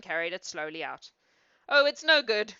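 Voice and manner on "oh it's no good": loud, raised lament; acted exclamation of despair; despairing cry. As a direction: cry out in frustration. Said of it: "cried the boy in despair".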